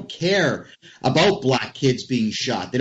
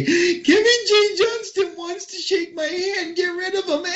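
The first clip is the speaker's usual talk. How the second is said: mocking whiny voice. Mocking rival tattling to cop.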